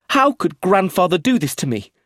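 high, loud, outraged cry; high and angry. A narrator's reading. Eustace betrayed by his grandfather, high and angry. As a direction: wail it in anger. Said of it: "his voice high and angry".

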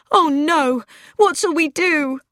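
high, loud wail of alarm; panicked cry. A narrator's reading. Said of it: "Mildred cried out".

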